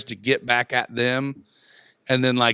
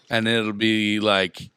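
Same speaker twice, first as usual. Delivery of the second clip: ordinary talk, nothing marked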